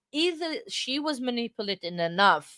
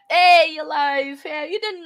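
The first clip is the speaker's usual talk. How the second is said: cheerful call-out; 7 semitones higher, 7 dB louder than usual. Real, not acted. Greeting a viewer who returned.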